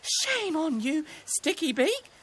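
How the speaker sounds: high, slow scolding rabbit; wet, affronted rabbit character; spluttering indignation. A narrator's reading. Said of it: "spluttered Roxy".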